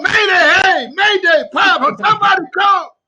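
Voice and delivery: loud, broken cry for help; desperate cry. Acted. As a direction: cry out for rescue, voice cracking. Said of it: "decide to put it down and call".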